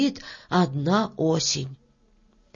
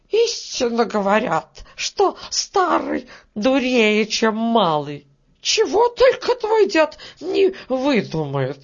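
put-on old beetle, scornful; 5 semitones higher than usual. Old beetle mocks Grandpa Prokhor to Varya, scornful. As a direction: scold like a peevish old beetle, pitched up.

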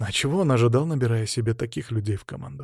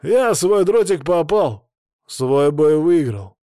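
slow, louder, emphatic self-defence; irritated boast; 4 semitones higher, 6 dB louder, slower than usual. A narrator's reading. Pakhan defends his own win to the host, loud irritation. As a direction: hammer each word out in annoyance.

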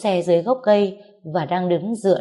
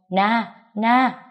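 higher-pitched call after someone; gọi với theo; 4 semitones higher than usual. A narrator's reading. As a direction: call out the name to catch up. Chú đuổi theo gọi Na. (gọi với theo, cao giọng)

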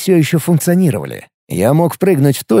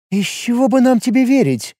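suspicious; 9 semitones higher than usual. A narrator's reading. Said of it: "с подозрением спросила Мария".

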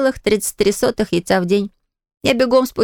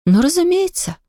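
sighing resignation; 8 semitones higher than usual. A narrator's reading. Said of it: "вздохнула мама".

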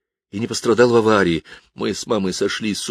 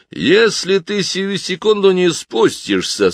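higher, slow and stern threat; 11 semitones higher, 4 dB louder, slower than usual. Acted. Piper threatens the stubborn boy, slow and stern. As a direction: warn the boy sternly, word by word. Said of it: "сурово произнёс дудочник".

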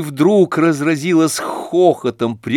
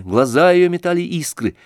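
plain reading voice

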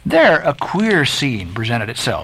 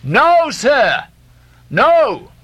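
high, loud call through cupped hands; shouted report; 10 semitones higher, 3 dB louder, much slower than usual. A narrator's reading. Stubb shouting his report up to Ahab. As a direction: trumpet it to the deck. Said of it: "molded his two hands into a trumpet and shouted".

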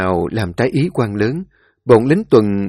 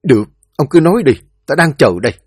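fast, higher and louder urging; impatient command; 4 semitones higher, 6 dB louder, much faster than usual. Acted. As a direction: push him to speak now, losing patience. Quan thúc thái y nói. (giục giã to và nhanh, mất kiên nhẫn)

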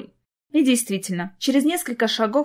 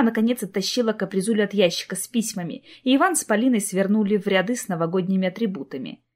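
usual speaking voice

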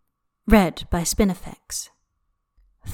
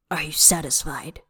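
whispered; 4 semitones lower, 7 dB quieter than usual. A narrator's reading. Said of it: "frail dragon prince".

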